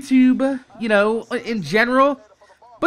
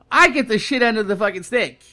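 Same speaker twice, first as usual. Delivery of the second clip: ordinary talk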